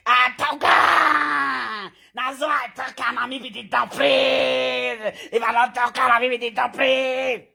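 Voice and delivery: shouted slow boast, far from his own voice; mock boastful shout. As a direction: shout it out like a braggart. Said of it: "Person wey go dey say".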